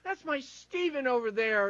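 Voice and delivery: proud sideline parents. Acted. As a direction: play a parent showing off their kid. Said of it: "there's all them going".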